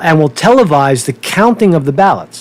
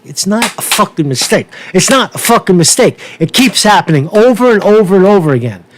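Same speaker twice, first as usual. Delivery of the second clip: ordinary talk, nothing marked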